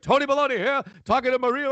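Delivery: high, put-on showman dad voice; hammed-up microphone bit; Tony Baloney. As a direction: announce yourself like a goofy home-video reporter. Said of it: "this, like, wired microphone".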